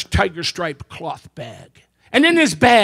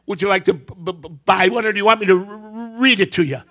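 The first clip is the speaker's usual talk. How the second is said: a job seeker stammering out his answer; stuttering; 2 semitones lower than usual. Acted. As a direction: stammer on the A before absolutely. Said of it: "a speech impediment".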